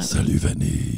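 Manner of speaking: low, breathy, menacing whisper; creepy thug waiting outside; voice from beyond the grave. Shown as a demonstration. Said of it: "C'est très épeurant".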